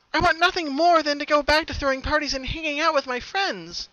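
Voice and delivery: desperate for answers; anxious high plea; pleading Pinkie. A narrator's reading.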